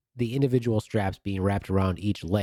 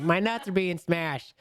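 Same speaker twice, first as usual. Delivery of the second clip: mock yell; 10 semitones higher, slower than usual. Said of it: "yelling down to them".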